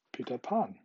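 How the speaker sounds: usual speaking voice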